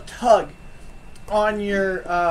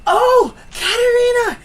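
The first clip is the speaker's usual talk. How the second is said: high, loud cry of Katerina; delighted old seer; 14 semitones higher, 11 dB louder than usual. Acted. Game master as Madame Eva greeting Katerina: high, loud delighted cry. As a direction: cry the name out in delight, much higher and louder. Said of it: "She goes".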